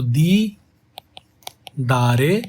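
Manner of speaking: दी then a pause, then दारे; split syllables. Shown as a demonstration. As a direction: say दी and दारे as two separate beats. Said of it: "ऐसे बोला जाएगा ना".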